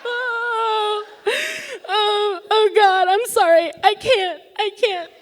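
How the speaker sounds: high, broken, sobbing apology; retold crying, pitched high; tearful apology. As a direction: choke out the sorry through tears. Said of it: "I am crying so hard".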